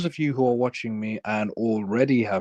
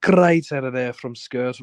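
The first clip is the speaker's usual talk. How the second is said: raised-pitch Scouse football patter; joking Scouse impression, pitched up; Scouse accent; 4 semitones higher than usual. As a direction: commentate on the match in a put-on Liverpool accent. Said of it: "doing an accent for no".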